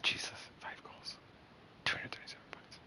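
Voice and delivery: hushed, unvoiced whisper over the scores; stunned whisper; awed whisper. Real, not acted. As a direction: whisper it in disbelief. Reading opponent stats in awe.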